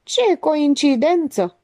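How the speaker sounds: high, drawn-out mock amazement; theatrical. A narrator's reading. Stratian reacts to the alibi talk, exclaiming theatrically. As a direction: stretch it out with stagy astonishment. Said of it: "exclamă teatral Stratian".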